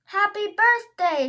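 high, loud party greeting; cheerful shout. Acted. Twelve princesses greeting Magnolia, cheerful shout. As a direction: shout it all together. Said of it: "shouted the twelve princesses".

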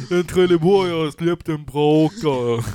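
loud, slow, broad Trelleborg dialect; mock Trelleborg accent. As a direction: ham up a Trelleborg local, stretching the vowels. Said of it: "speciellt om man tänker då att det är".

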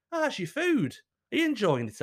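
high, sing-song polite inquiry; lilting, put-on mealtime question; solicitous cook. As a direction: act a cook sweetly checking on the diner. Voicing the cook checking on diners.

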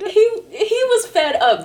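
high, loud, amused emphasis; laughing. Real, not acted. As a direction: squeal it out through laughter. Cracks up retelling friend's rant.

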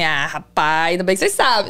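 low, teasing joke; joking. Retold or imitated. Police officer joking with the promoter, low and teasing. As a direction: tease the promoter with a playful low voice. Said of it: "Tipo assim, né, brincando".

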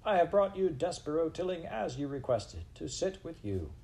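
high-pitched formal announcement, easing off; shouted proclamation. A narrator's reading. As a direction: proclaim it to the assembly. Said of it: "shouted Furlow".

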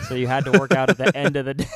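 talking through laughter; laughing. Real, not acted. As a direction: laugh the words out. Laughing while speaking.

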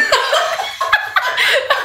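long high-pitched laugh. Real, not acted. Laughing at the typo.

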